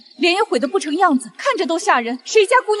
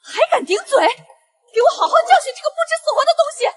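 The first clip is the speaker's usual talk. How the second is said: high, fast, shouted orders; furious shout; 10 semitones higher, 5 dB louder, faster than usual. Acted. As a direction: rage at the insolent maid. Concubine ordering maid beaten.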